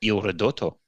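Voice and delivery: Il Ridotto with a trilled r in Ridotto; rolled r. Shown as a demonstration. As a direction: roll the r hard in Ridotto. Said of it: "rolling my R's".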